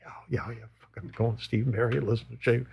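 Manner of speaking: hushed, whispered glee; excited whisper. Retold or imitated. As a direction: whisper it, barely containing excitement. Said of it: "we're going like".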